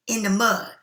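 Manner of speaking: usual speaking voice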